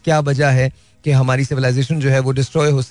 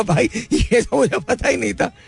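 mock-astonished, slow and higher-pitched; imitated amazement; 7 semitones higher than usual. Stunned meeting partner tells the speaker, mildly imitated amazement. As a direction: lightly act out someone stunned, drawing it out. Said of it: "वो मेरी शक्ल देख रहा है ना यूं करके".

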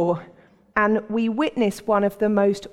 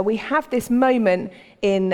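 ordinary delivery

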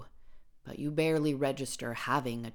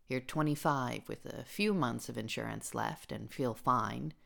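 ordinary talk, nothing marked

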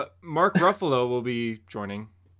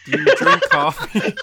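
much higher and louder, laughing; silly high voice; 8 dB louder than usual. Acted. Asking Harper about coffee, in a much higher, louder laughing squeak. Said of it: "Why do you have to say it like that".

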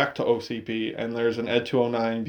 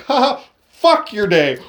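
higher, louder sneering laugh line; mocking taunt; 6 semitones higher, 6 dB louder than usual. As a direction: gloat right before you fire. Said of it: "Lewis is like".

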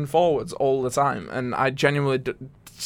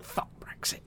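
a hushed, mock-furious tweet; breathy whispered curse; whispered quote; 13 dB quieter than usual. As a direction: whisper it under your breath. Quoting his own angry tweet.

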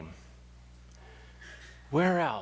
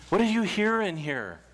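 ordinary talk, nothing marked

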